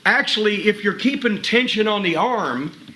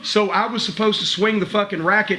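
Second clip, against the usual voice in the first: usual speaking voice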